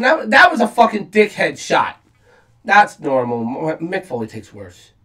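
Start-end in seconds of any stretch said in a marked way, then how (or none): none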